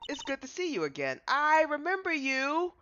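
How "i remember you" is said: puzzled recognition. Acted. Slow remembering build.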